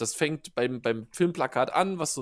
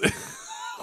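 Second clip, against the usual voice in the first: burst of laughter; 3 dB quieter than usual. Real, not acted. Laughing at Robo Chris.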